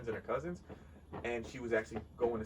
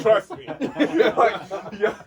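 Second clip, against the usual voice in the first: laughing; 10 semitones higher, 15 dB louder than usual. Real, not acted. Laughing at brother's joke.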